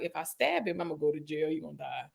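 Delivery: low, hushed thinking aloud; quiet inner thought. Retold or imitated. Staring at the kitchen knives, a hushed inner thought. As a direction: say it under your breath like a dark thought. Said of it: "I was like".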